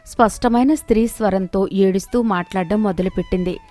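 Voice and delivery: ordinary delivery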